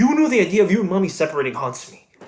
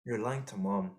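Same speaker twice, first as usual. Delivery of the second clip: hushed accusation; 9 semitones lower, 14 dB quieter than usual. Acted. Mom is in earshot.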